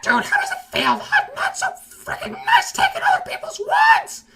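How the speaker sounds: put-on squeaky, very high, shouting kid; gloating kid. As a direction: taunt like a shrieking eight-year-old. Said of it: "He's just in the chat like".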